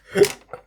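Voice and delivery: short high laugh; a quick squeaky laugh; high burst of laughter. Real, not acted. Laughs at the plot.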